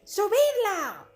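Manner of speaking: orden gritada, lenta y aguda. Acted. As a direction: ordena a gritos que la suban. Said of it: "ordenó Talik". (Talik grita a los soldados)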